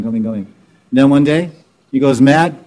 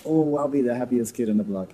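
dreamy gushing buyer; 9 dB quieter than usual. Retold or imitated. Imitating a buyer's dreamy boast.

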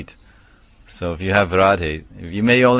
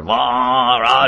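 big, sustained booming call; imitated amplified voice; 3 semitones higher than usual. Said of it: "it's like a microphone or".

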